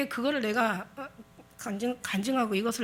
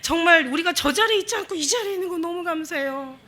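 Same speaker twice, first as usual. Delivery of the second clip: fervent praying voice; 6 semitones higher, 4 dB louder than usual. Pretend. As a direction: pray it out like a rapt worshipper. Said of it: "한번 가가지고 또 시작 막". (간절히 기도하는 목소리)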